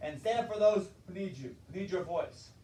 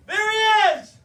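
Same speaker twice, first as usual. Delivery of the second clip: much higher, loud joyful shout; excited cry; 12 semitones higher, 11 dB louder, slower than usual. Retold or imitated. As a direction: yell it out in delight. Girl finds her bear.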